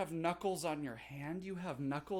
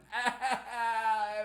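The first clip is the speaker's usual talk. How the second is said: short laugh; 7 semitones higher, 5 dB louder than usual. Real, not acted. Laughing at dance mishaps.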